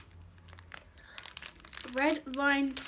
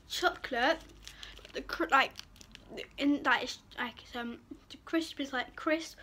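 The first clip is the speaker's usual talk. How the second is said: speaking between bites; chewing while talking. Real, not acted. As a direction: mouth partly full of candy. Seen 2: Holds bitten bar; cheeks full, chewing between phrases throughout.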